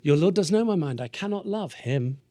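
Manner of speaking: lifted pitch, lingering on the last words; suddenly smitten. Acted. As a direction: let the vow slip on seeing someone lovely. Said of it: "Enter really cute boy".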